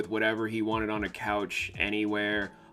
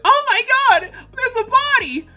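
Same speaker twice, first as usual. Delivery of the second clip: very high, loud shriek of alarm; panicked crowd member; 9 dB louder, faster than usual. Acted. Background crowd actor finding a body, shrieking in alarm. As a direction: shout it out in high-pitched shock. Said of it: "being in the crowd's like".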